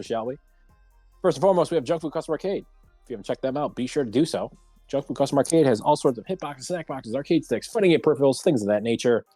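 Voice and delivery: ordinary talk, nothing marked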